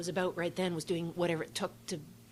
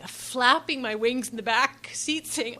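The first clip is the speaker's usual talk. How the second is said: ordinary delivery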